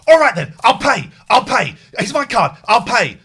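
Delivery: loud, high furious yelling; angry shout. Retold or imitated. Furious customer yelling he will pay the tow fee. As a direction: shout each "I'll pay" hard. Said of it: "I'm getting angry and I'm shouting".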